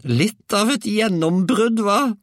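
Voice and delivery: very high, loud, drawn-out crowing; grandmother's shout. A narrator's reading. Grandmother boasts of breaking through the roof, high slow shout. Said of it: "ropte hun".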